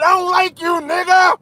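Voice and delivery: high mocking mimic of the caller; mocking imitation. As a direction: mimic him in a squeaky taunting voice. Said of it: "he said".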